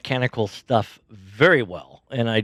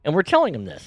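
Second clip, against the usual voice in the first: ordinary talk, nothing marked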